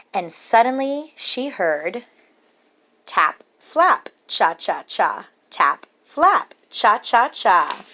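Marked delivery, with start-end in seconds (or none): none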